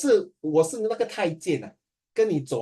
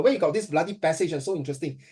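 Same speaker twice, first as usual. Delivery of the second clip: ordinary talk, nothing marked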